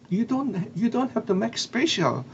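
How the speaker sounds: polite protest. Retold or imitated. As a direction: retell a modest "no need" in a lifted voice. Acts own polite protest to chef.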